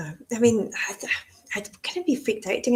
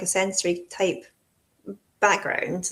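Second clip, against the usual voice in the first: ordinary talk, nothing marked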